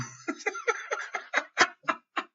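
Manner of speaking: a high unvoiced laugh at the punchline; breathy high laugh. Real, not acted. Laughing at his own story about the friend zone friend. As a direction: laugh out at the absurd ending.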